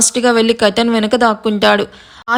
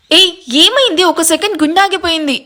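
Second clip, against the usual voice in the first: startled; 6 semitones higher than usual. Acted. Startled by the scream.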